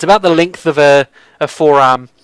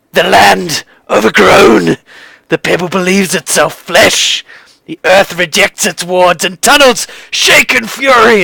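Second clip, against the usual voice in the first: raised, forceful prophetic ranting; seer's furious outburst; 4 semitones higher than usual. Acted. Red-faced seer raves omens before slamming his fist, fervent. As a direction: build the omens toward a furious peak.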